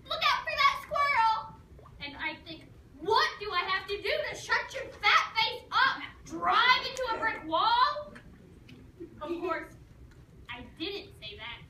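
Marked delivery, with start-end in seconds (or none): 0.0-1.5 much higher, louder alarmed mom. Retold or imitated. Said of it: "Then she'd say". 3.0-8.1 put-on teen silently raging at her nagging mom; exasperated rant. Fed-up thought about mom.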